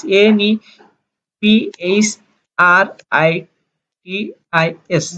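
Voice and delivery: "N E P H R I T I S" spelled out one letter at a time with pauses. Shown as a demonstration. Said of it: "আমাদের nephritis". (অক্ষর ধরে ধরে থেমে থেমে বানান করা)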